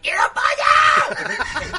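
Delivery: very high, slow, loud bratty scream; tantrum shriek. Pretend. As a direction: wail for chicken like a toddler queen. Reina grita pidiendo pollo. (reina caprichosa chillando por pollo como una niña)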